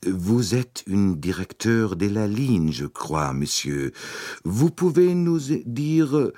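slow, carefully articulated French, each syllable placed. A narrator's reading. Arbuthnot addressing Poirot in careful, slow French. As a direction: speak French deliberately, a bit stiff. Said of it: "in sorgfältig gedrechseltem Französisch".

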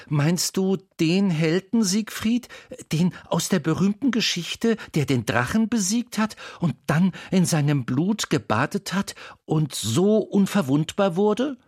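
put-on a boy stammering in wonder; young Wendel. A narrator's reading.

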